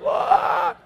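high-pitched imitated bawl; short, high fake cry; mock crying wail. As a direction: wail like a frightened little boy. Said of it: "He starts crying".

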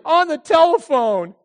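high, loud insistence; hammed-up frightened daughter; shaken daughter.

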